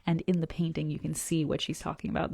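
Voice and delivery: ordinary delivery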